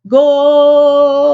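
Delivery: long, high, loud held "Goal"; soccer announcer. Retold or imitated. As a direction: imitate a Spanish-broadcast commentator holding the goal cry. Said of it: "the announcer says".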